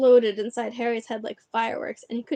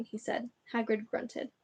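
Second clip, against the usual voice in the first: ordinary delivery, nothing marked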